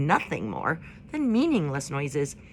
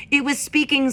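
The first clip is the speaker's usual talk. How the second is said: higher, louder owl addressing a crowd; hooting owl; 8 semitones higher, 6 dB louder than usual. A narrator's reading. Owl calling the meadow crowd to order, higher and louder. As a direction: hoot it out from the branch. Swooper voiced.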